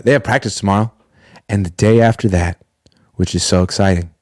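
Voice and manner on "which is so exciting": low, unenthused sarcasm; dry, sinking monotone; deadpan fake excitement. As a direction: claim excitement with none in the voice. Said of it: "Can you hear the excitement in my voice?".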